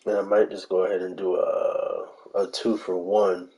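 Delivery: ordinary talk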